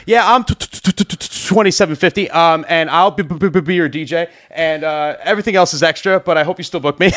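high, stuttering mock nerves, laughing at the end; nervous stammering. DJ imitating himself quoting a client nervously, stammering. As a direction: stammer the new price like you dread saying it.

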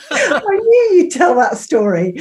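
high, loud laughing protest. Real, not acted. Vicar teased by old friend over a story, laughing. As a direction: burst out laughing as you say it.

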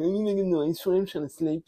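put-on high, whiny teenage complaint; mimicking the teenage daughter. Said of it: "she's like".

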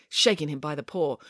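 plain reading voice

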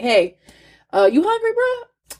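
high, breezy tempter; lightly voiced devil, casual bro. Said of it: "the enemy is, like, talking to him".

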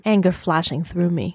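usual speaking voice

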